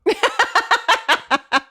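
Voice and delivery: a pained laughing squeak; embarrassed laughing protest; high laughing whine. Real, not acted. Embarrassed after reading aloud.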